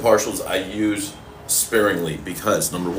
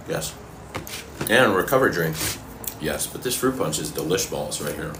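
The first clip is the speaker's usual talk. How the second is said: usual speaking voice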